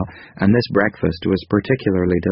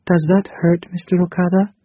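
higher, gentle female character voice; 11 semitones higher than usual. A narrator's reading. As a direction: voice Krita Kano lightly higher. Narrator voices Krita Kano.

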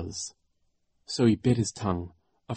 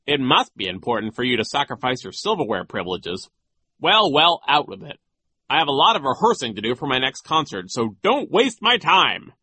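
impatient, pompous official, rising in pitch and force; self-important scolding; nasty; 6 semitones higher, 5 dB louder than usual. A narrator's reading. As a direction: hurry them along nastily, ending high, loud and stretched. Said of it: "in his usual nasty way".

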